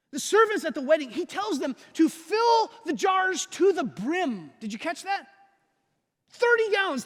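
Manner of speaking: high-pitched, urgent sermon delivery; impassioned preaching. Real, not acted. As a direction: press the challenge on the congregation. Preaches challenge to the church.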